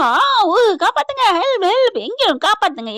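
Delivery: high, loud acted cries; screaming for help. Attacked woman calls for someone to save her, high screaming. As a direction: wail out for rescue, pitch leaping. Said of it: "கத்துறாங்க".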